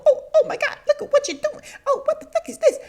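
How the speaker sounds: giddy high-pitched outbursts; excited squeal. Retold or imitated. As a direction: squeal in disbelief. Robin reacting to video.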